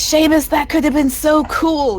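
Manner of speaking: high-pitched scolding, fading at the end; exasperated whine. Acted. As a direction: lament a friend's spoiled stunt. Scolds friend for ruining the escape.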